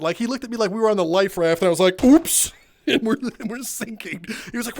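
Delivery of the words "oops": mock oops. Narrator after the call.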